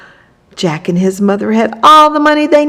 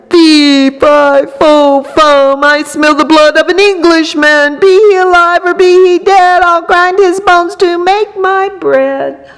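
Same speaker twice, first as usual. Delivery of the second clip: booming giant roar, louder and higher; thundering giant; 8 semitones higher, 4 dB louder than usual. A narrator's reading. Giant thundering at the hidden boy. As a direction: thunder it out like a huge angry giant. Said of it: "stomped into the castle and thundered".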